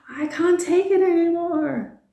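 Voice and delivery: high, loud exasperated cry; mock overwhelmed cry. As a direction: burst out like you're drowning in questions. Overwhelmed learner cracking.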